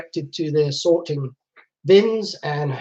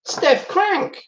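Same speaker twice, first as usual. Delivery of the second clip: surprised recognition; 12 semitones higher than usual. Real, not acted. Recognizes same sender on picture.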